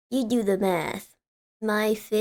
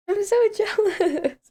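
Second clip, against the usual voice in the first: laughing jealous squeal; 10 semitones higher than usual. Real, not acted. Squealing, laughing over friends.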